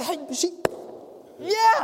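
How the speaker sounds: high, flustered and breathless; excited servant girl. Acted. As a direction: squeal it in a rush of joy. Rhoda freaks out.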